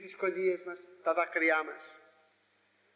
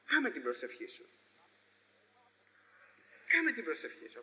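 ordinary talk, nothing marked

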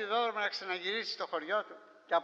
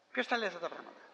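ordinary talk, nothing marked

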